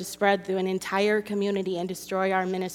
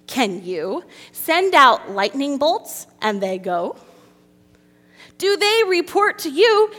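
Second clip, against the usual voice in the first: loud, high, booming reading of God's challenge; dramatic scripture reading; 4 semitones higher, 8 dB louder than usual. Acted. Preacher reading God's words aloud to the ladies, booming. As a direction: proclaim it like thunder from heaven.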